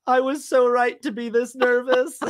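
hammed-up self-pity; mock crying. Co-host continues the bombing bit, mock teary. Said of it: "I'd probably just start crying".

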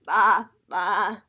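low, loud, wavering baas; sheep bleat. A narrator's reading. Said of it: "said the sheep".

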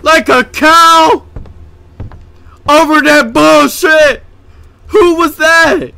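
high, outraged disbelief after a scare; real startled, indignant shouting; shocked yelling. Real, not acted. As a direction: yell it high, reeling from a jump scare.

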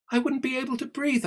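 high alarmed protest; object, alarmed. Acted. Alice protests to Celia about breathing undersea. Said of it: "protested Alice".

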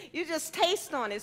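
ordinary talk, nothing marked

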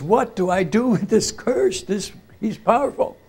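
imitating a scared priest asking for help; retold scared plea. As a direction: plead like a frightened man, lightly acted. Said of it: "he is scared".

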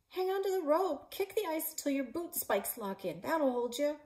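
high, loud shout up the ice; urgent shout. Acted. Climber shouting to her partner on the ice, loud urgent. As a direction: yell the orders so he holds on. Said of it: "Chase shouted".

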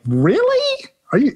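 high, startled disbelief; can't believe they picked you; incredulous. Retold or imitated. New puppeteer asked to perform, high incredulous squeak. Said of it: "I'm like".

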